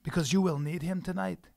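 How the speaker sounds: menacing Scottish threat, kept low; quiet Highland Scot making a threat; Scottish accent. Acted. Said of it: "Your Scottish voice".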